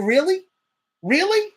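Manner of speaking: "Really?" shot up very high in disbelief; incredulous really. Real, not acted. Host outraged at the team over the player's contract, disbelieving "Really?". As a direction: sound stunned and outraged on "Really?".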